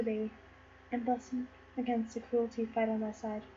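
low, very soft voice; tender blessing. Acted. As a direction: bless the baby in a hushed murmur. Blessing spoken to the baby.

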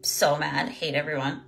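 low, very soft grumble; retold sulky anger. Coach replaying herself holed up in her castle, sulking mad. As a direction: re-enact sulking to yourself, voice sunk low and small. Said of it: "I was in there like this".

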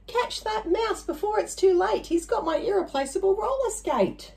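high-pitched mock shout. A narrator's reading. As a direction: call out after the thief.

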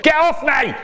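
high, loud yell; child's yell. Acted. Boy yells at dad grabbing his cheese, high and loud. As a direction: shriek it like a grabbed kid.

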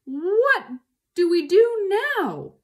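high, loud, angry question; exasperated shout. A narrator's reading. Boggis to the other farmers, loud exasperated shout. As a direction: demand it at full voice.

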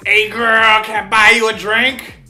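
loud, put-on ladies' man; pick-up line. Shown as a demonstration. Showing how to approach a girl, hammed-up loud come-on. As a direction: lay it on thick, loudly.

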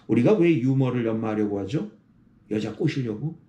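normal talking voice

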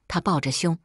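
usual speaking voice

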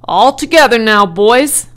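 loud rallying call to helpers; rally the men for a heave; hearty, loud foreman's call. A narrator's reading. Man calling helpers to lift.

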